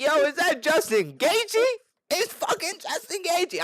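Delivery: very high, starstruck squeal of recognition; fangirling. Retold or imitated. Smoker spots Justin Gaethje outside, starstruck fangirling. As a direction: gush as you spot your idol. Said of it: "I literally fangirled".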